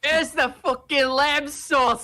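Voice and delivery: high, drawn-out mock chef's yell; mock furious shout. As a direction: shout it like an enraged TV chef. Group quoting Gordon Ramsay's kitchen outbursts.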